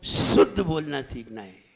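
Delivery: शुद्ध with a loud, long hissed opening sibilant; demonstrated sibilant. Shown as a demonstration. Preacher shows listeners how to say it, emphatic sibilant on शुद्ध. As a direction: lean hard on the whistling first sound of शुद्ध, then let the rest fall. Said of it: "आवाज देखिए".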